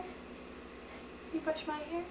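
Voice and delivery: quiet, slow little ask; soft coaxing. Real, not acted. Mother coaxing toddler softly.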